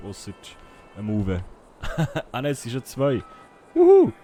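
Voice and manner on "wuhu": high, loud whoop; excited cheer. Real, not acted. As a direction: shout a happy whoo. Cheering on stream start.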